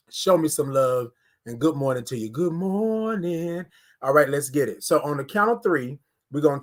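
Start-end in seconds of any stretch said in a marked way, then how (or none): 2.3-3.7 stretched greeting. Acted. Drawn-out greeting to viewers.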